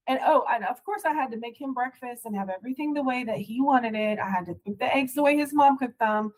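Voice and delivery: ordinary talk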